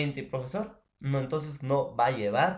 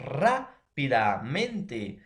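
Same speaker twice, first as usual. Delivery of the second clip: "Rápidamente" very slow, RÁ hit hard; drawn-out stressed syllables; 3 dB louder, much slower than usual. Shown as a demonstration. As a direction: stretch "Rápidamente" out, punching the first syllable. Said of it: "tiene un acento de voz".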